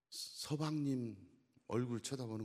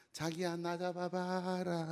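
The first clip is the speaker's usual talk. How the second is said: coy wife; 5 semitones higher, slower than usual. Retold or imitated. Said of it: "서울 아내가".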